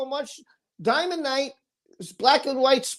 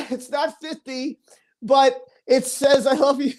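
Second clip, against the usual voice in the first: laughing while reading out the message; amused laughter; 2 semitones higher than usual. Real, not acted.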